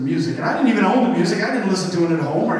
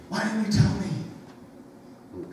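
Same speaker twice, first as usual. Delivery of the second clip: pleading reproach; 8 dB quieter, much slower than usual. Pretend. Imagined reproach at judgment.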